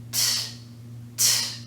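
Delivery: crisp voiceless "t", no vowel after it; isolated T sound. Shown as a demonstration. Teacher showing learners the T sound, isolated voiceless "t". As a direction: say "t" as a bare burst of air, spaced apart. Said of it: "the sound with no vibration".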